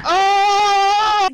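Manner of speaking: loud, high delighted shout; thrilled loud exclamation; excited oh. Real, not acted. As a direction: yelp with delight. Excited reaction to answer.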